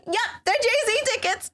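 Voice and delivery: high, bright-eyed announcing; spring the surprise gleefully; proud gift reveal, voice up high; excited reveal. Retold or imitated. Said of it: "I'm like".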